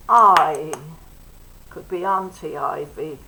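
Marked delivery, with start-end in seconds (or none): 0.1-0.7 loud long I as in ivy; a ringing long I; long I, called out. Shown as a demonstration. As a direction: call out the long I sound.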